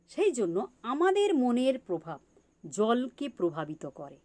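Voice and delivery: ordinary delivery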